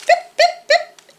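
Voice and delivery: very high, short pips; peeping robin. A narrator's reading. Narrator voices the robin near Owl, tiny high peeps. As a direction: peep like a little bird. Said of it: "The robin peeped".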